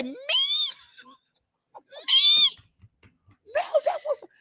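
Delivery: put-on high, wide-eyed child voice denying; innocent kid. Boy denying, acted innocent.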